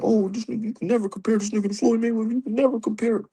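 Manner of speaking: high, fast mocking whine of the haters; mocking the haters, up high and quick. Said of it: "I know y'all hate it".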